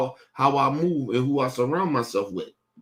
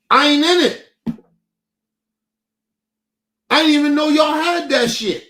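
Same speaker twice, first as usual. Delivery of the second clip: angry shout; 10 semitones higher, 6 dB louder than usual. Real, not acted. Furious about family chat exclusion.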